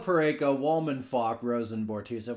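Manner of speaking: ordinary talk, nothing marked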